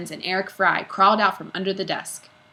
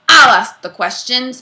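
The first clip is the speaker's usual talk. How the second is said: slow, loud barked order; stern principal barking, slow and loud; 5 semitones higher, 13 dB louder than usual. Acted. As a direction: bark it to shut him up. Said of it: "barked Mister Kidswatter".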